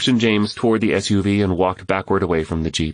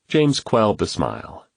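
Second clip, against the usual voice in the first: ordinary delivery, nothing marked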